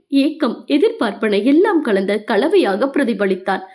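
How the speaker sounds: usual speaking voice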